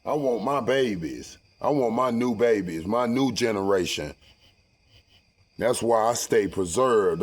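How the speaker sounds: usual speaking voice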